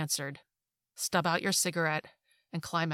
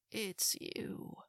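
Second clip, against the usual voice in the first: faint mumble; 4 semitones higher, 9 dB quieter than usual. Acted. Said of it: "she says".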